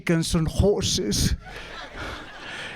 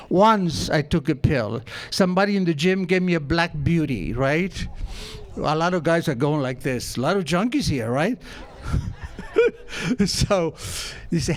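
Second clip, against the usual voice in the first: usual speaking voice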